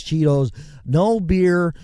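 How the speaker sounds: normal talking voice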